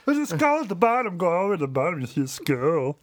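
high, excited nerd; squeaky, eager nerd voice. Retold or imitated. Mocking Titus's nerd voice.

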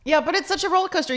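excited. Real, not acted. Excited agreeing.

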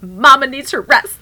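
loud, high comic declaration; mock outburst. Done mom justifying herself to her husband, mock outburst. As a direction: proclaim it at full volume, breaking into laughter. Acting out being done, laughing after.

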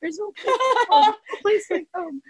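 high-pitched, hammed-up weepy wail; mock crying. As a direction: whimper it like you're pretending to bawl. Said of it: "I'm, like, crying".

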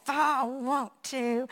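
slow, drawn-out echo of the son's words; slow motion. Retold or imitated. Mother replaying her son's challenge in memory, very slow. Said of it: "hearing it in slow motion".

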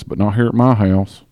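ordinary talk, nothing marked

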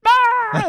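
piercing high-pitched shriek; loud mock scream. Dad imitating his kids hearing about circumcision, shrieking. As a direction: imitate kids shrieking in horror, full volume. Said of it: "that was probably loud".

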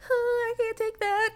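high, strained whimpering voice; high mock whimper. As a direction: squeal it like a wimp. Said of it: "I'm just like".

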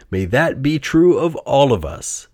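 ordinary delivery

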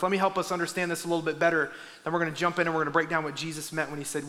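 ordinary delivery

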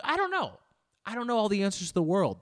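high, loud defensive outburst; mock exasperation. Real, not acted. Podcaster unsure of French pronunciation, mock-exasperated outburst. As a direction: protest loudly, pitch jumping up.

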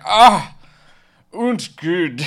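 high, loud mock German exclamation; a hammed-up German-accented sigh of approval. Host imitating Heinrich in a mock German accent.

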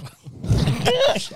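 squealing laugh up high; helpless high-pitched laugh; high burst of laughter. Real, not acted. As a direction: let a high giggle burst out.

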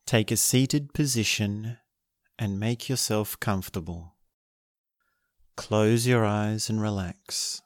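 guided meditation. Acted. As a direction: guide them gently into stillness.

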